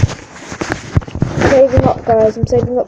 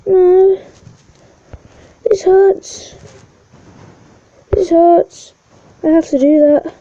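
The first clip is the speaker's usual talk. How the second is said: pained whining; 6 semitones higher, 4 dB louder than usual. Real, not acted. Losing money in game, whining.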